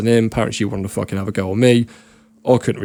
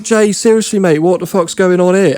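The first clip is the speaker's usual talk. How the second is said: higher and louder, baffled at his mate; shocked call-out; 8 semitones higher, 5 dB louder than usual. Retold or imitated. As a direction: call across the room in disbelief. Confronting his mate mid-brawl.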